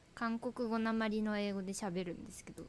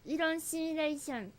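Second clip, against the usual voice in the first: put-on Korean accent; 7 semitones higher, 4 dB louder than usual. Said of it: "韓国語なまりで喋ってくれてるんですよ".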